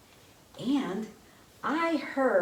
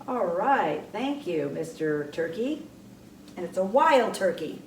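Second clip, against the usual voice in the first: ordinary talk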